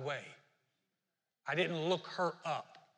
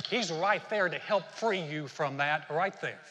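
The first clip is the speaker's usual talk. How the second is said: ordinary delivery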